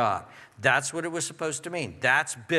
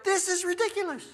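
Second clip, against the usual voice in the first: high, squeaky disbelief without volume; mock incredulity; 14 semitones higher than usual. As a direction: imitate scandalized reformers, pitch shooting up. Reformers' acted incredulous reaction.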